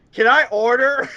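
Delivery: playfully yelling "Can I order?" into a drive-thru speaker; mock yell. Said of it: "kept yelling at the speaker going".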